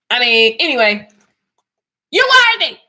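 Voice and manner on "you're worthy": higher and louder; emphatic. Real, not acted. Telling viewers they're worthy, loud and bright. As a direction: declare it like a blessing. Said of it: "emphatic affirmation".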